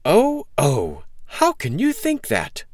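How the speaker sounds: put-on high-pitched, wounded innocence from a boy; protesting cry. A narrator's reading. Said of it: "cried Jack".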